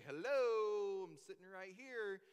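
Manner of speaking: a punchy mock-indignant hello, then dry; lightly voiced sarcastic king; sarcastic Herod. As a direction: Herod pointing out the obvious, irritated. Herod answering the wise men.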